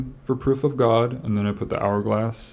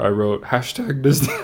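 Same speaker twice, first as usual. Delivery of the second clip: voice cracking; 5 semitones higher, 4 dB louder than usual. Real, not acted. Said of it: "My voice just cracked".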